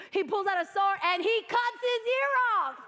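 emphatic excited exclaim. Real, not acted. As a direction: preach it with rising wonder. Excited climax about Peter.

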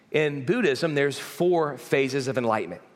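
ordinary talk

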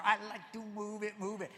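lemur from Madagascar. Retold or imitated. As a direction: bounce out the movie line like the cartoon lemur. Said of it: "Madagascar, right? The little lemur".

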